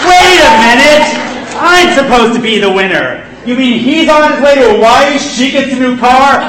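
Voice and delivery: loud, high outraged protest; indignant shout. Acted. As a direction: yell at the unfairness. Contestant protests unfair outcome.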